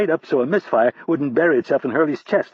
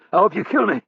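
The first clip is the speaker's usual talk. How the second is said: higher, louder fierce challenge; defiant taunt; 5 semitones higher, 3 dB louder than usual. Acted. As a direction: spit the threat back at him. Defiant prisoner taunts captor.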